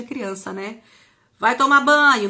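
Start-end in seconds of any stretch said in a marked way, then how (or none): 1.4-2.3 imitating a mother's order. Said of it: "as mães colocam na gente".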